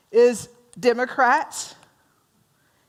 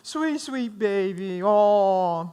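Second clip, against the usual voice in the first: put-on baby talk; 2 semitones lower, much slower than usual. Teacher showing how she coos at her own puppy, tender. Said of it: "what I say about my puppy".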